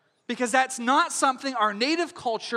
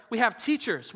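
normal talking voice

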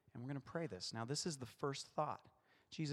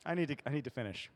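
ordinary talk

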